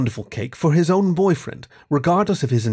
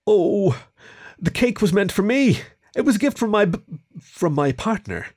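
higher-pitched, faltering confession; embarrassed mumble; 8 semitones higher than usual. A narrator's reading. Kieran admits the cake was his gift, mild mumble. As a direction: stumble sheepishly over who gave it. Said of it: "Kieran mumbled".

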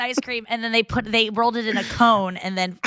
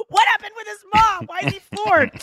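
mock alarmed shout; 12 semitones higher than usual. Mishearing flawed, she yells back in alarm. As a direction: yelp it out in fake confusion. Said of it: "I'm like".

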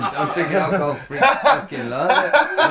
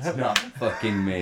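ordinary talk

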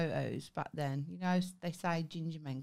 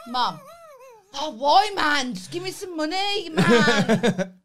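high, very loud hammed-up protesting son; Ben demanding money; 10 semitones higher, 11 dB louder, slower than usual. Son pleading with Mum for money, loud mock outrage. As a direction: wail at Mum like a thwarted son. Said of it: "It's a good impression".

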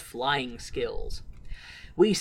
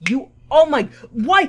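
normal talking voice

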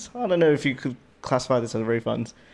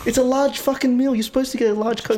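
exasperated rant; 12 semitones higher than usual. Retold or imitated. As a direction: still fuming about the Coke. Re-enacting his complaint.

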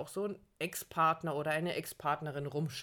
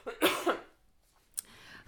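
throat-clearing between words; coughing; 6 semitones higher than usual. Real, not acted. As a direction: talk through a cough. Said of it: "ich muss husten, Entschuldigung".

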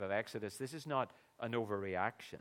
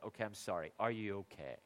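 sheepish apology; 5 semitones lower, 7 dB quieter than usual. Retold or imitated. Scolded dad apologises.

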